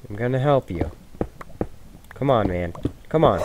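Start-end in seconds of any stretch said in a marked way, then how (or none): none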